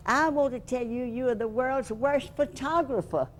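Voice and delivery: high-pitched imitation of a Scottish scolding; a hint of Scottish, high and reproachful; Scottish accent. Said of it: "in his Scottish accent".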